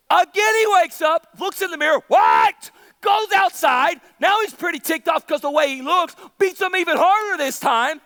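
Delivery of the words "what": short, loud outburst of disbelief; shocked yell. Acted. Preacher plays Samson seeing the mirror, shocked yell. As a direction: bellow it in mock shock.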